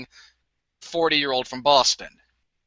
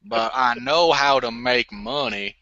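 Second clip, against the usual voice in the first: Forrest Gump; 2 semitones lower than usual. Acted. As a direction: draw out the last words like a simple country man. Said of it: "Forrest Gump".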